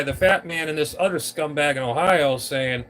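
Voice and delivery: normal talking voice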